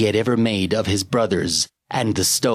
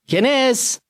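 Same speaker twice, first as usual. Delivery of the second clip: calling out. Acted. Calling out into dark cave.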